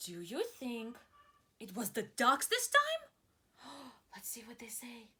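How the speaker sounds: breathy suspenseful hush; teacher building suspense; gasping hush. Acted. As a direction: gasp and drop to a whisper.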